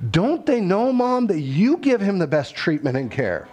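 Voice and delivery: put-on a nine-year-old's indignant question; exasperated kid. Son protesting to his mom, exasperated and high. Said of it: "He goes".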